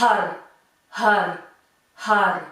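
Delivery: "Har" with a breathy H and a rolled R; "Har" pushed out with rolled R. Shown as a demonstration. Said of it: "essayez de rouler les R".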